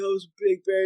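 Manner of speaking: high-pitched imitation of a rattled man; mock-trembly awe after a close call; shaken dad. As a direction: play the spooked father. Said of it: "like, shaking".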